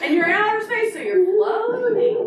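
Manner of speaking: high, loud excited calling; excited game calling. Real, not acted. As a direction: call it out in a high, thrilled voice. Acting out dance moves excitedly.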